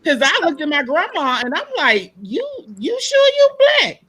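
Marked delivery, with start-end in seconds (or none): 2.2-4.1 much higher, mock-incredulous "you sure"; teasing disbelief. She teases her light-skinned grandma in mock disbelief.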